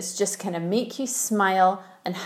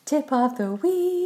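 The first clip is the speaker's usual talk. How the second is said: stiff robot voice, slightly higher; 6 semitones higher, slower than usual. Acted. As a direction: say it like a mock robot. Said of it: "I was trying to do my robot voice".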